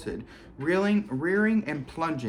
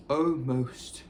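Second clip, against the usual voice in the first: ordinary delivery, nothing marked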